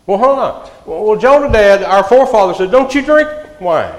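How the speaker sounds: high, loud, hammed-up protest; indignant refusal. Rechabites refusing the wine, high and indignant. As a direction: bristle as if the old ban is sacred.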